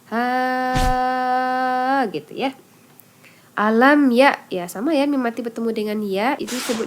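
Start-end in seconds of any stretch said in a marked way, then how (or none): 0.1-2.6 "Ha" held out long for five counts; long five-beat vowel. Shown as a demonstration. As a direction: stretch the "Ha" to five harakat. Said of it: "kita pilih aja lima harokat".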